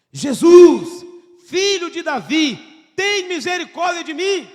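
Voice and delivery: loud desperate cry, slow on filho de Davi; shouted plea. Retold or imitated. Blind man screaming over the crowd to reach Jesus. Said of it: "Ele gritou mais alto".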